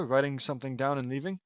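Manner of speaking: usual speaking voice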